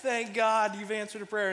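high, loud relieved cry; mock relief. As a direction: play up a dad's answered prayer. Acted relief at solving the riddle.

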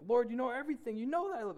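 high, loud, worked-up protest; flustered. Retold or imitated. Peter protesting to the Lord, flustered. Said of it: "Peter kinda getting flustered".

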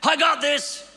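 shouting to him to swim back; urgent yell across the water; father calling his son back from the shore. Retold or imitated. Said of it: "I heard the words again".

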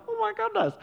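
squealed high with delight; a burst of high mock glee; mock excited squeal. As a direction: play it like a kid wanting a toy. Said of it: "I'm like".

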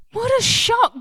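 high, loud cry of shock; shocked shout. Real, not acted. Repeated exclamation, second shouted.

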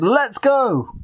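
high excited shout, lightly acted. A narrator's reading. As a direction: call out eagerly to go. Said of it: "slammed the door shut and shouted".

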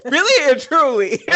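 laughing out loud while agreeing. Real, not acted. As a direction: laugh through the words. Laughing agreement between friends.

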